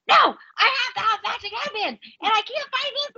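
put-on high, whiny teen daughter in a meltdown. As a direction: play the frantic teenager who can't find her headband. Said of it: "full freaking tornado meltdown".

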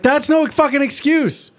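hammed-up scolding; mock angry retort. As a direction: Twilight scolding Spike. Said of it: "she was like".

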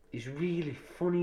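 normal talking voice

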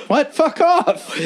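high, laughing mock woman telling him off; mock woman's voice. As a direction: squeak it out like she's brushing him off.

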